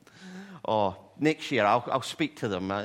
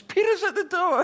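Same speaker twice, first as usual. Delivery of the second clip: much higher, loud and squealing; excited shriek; 10 dB louder than usual. Retold or imitated. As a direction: burst out with the news. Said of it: "she runs up and says".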